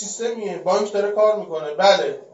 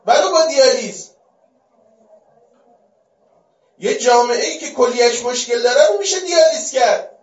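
loud, high pulpit climax; raised preaching voice; forceful preaching; 5 semitones higher, 6 dB louder than usual. Real, not acted. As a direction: drive the point home to the congregation. Preacher building to full force.

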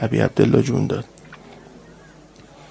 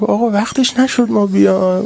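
higher, rising cry of the plea; lamenting plea; 7 semitones higher than usual. Acted. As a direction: plead it like a grieving child in a rowzeh. Lament climax, child's plea. (التماس سوزناک با صدای بالاتر)

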